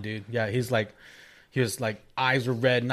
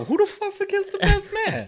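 incredulous. Real, not acted. Incredulous outburst.

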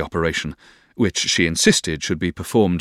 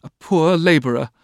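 put-on high, pitying girlish cry; exclaiming; 9 semitones higher than usual. A narrator's reading. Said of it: "began exclaiming".

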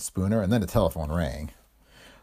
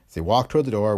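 ordinary delivery, nothing marked

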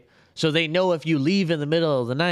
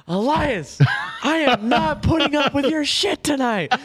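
exasperated outburst, acted out; retold angry yell; 9 semitones higher than usual. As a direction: re-enact yelling at a roommate. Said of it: "you start screaming at someone else".

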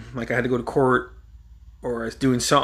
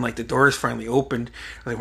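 ordinary talk, nothing marked

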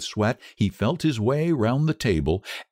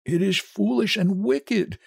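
higher, reluctant protest; faltering; 12 semitones higher than usual. A narrator's reading. Frightened husband resists his wife, faltering. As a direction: object weakly, afraid. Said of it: "he faltered".

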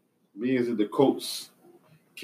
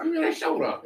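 put-on Minnesota accent, pitched way up and falling; mock Minnesota accent. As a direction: imitate a Minnesotan saying Minnesota, very high. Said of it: "That's how they talk".

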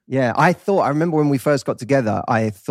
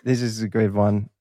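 ordinary delivery

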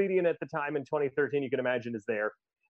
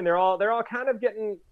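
usual speaking voice

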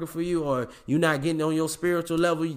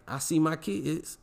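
ordinary delivery

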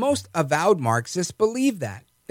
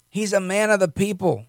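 normal talking voice

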